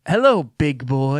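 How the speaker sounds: slow, lightly put-on hotline voice; mock sultry greeting. Co-host joking about naughty phone numbers, in mock seductive voice. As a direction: tease it out slowly, hammed-up.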